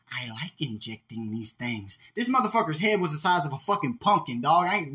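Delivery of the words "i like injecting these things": imitating interviewee. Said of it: "he said".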